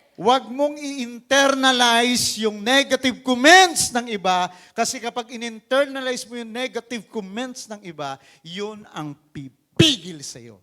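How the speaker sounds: loud, slow, urgent exhortation; full-force preaching. Real, not acted. Preacher urging the congregation not to internalize insults, loud and slow. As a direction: drive the point home to the congregation.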